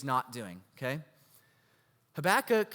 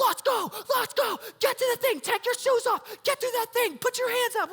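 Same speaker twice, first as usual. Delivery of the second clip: frantic, much higher, louder hurried dad; dad rushing us; 4 dB louder than usual. Retold or imitated. Dad hustling his kids through airport security, frantic and much higher. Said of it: "He's like".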